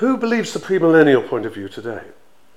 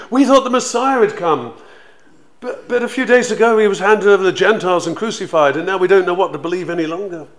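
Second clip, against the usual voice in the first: louder, higher, pouring out bewildered dismay; distressed travellers; 5 semitones higher, 8 dB louder than usual. Retold or imitated. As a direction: let the confusion tumble out. Travellers pour out their dismay.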